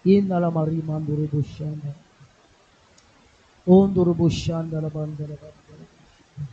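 loud chanted tongues, bursting out on each "Oh"; loud ecstatic tongues with sudden strong calls; praying in tongues. Acted. Pastor prays in tongues.

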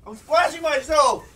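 high, loud, frantic shouting; pained yelling. Real, not acted. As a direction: scream it out from the burn. Screaming in pain from chip.

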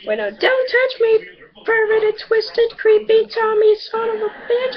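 high, loud mock-hysterical screech; hammed-up high girly scream; mock girl shriek. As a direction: shriek it like a freaked-out game girl. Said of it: "She said".

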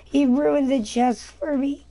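milk the outrage, drawn out; hammed-up slow indignant whine; exaggerated whine. Real, not acted. Complains about ruined chest.

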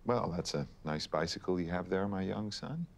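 put-on American accent. Shown as a demonstration. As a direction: say it as an American would. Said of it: "Can you do an American accent?".

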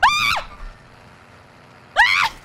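short high, loud shriek; sharp high scream of alarm; startled shriek. Real, not acted. Gamer under attack, short loud shrieks.

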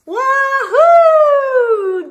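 high, loud whoop of delight; joyful yell. Acted. Snowmen sledding down the hill, joyful yell. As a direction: shout it down the hill. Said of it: "they yell".

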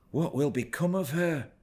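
high, anxious exclamation; alarmed cry. A narrator's reading. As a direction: blurt it out in alarm. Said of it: "I ejaculated".

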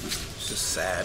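deflated. Real, not acted. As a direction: let the air out of it, disappointed. Deflated by team's play.

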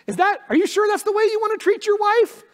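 high, loud, pointed challenge; mock conscience. Pastor voicing the Spirit's sharp question, high and pointed. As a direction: needle yourself like an inner voice. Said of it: "the question will be like".

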